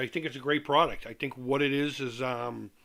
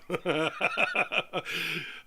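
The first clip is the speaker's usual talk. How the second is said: amused laugh; 4 semitones higher than usual. Real, not acted. Laughs about pissing off Rab.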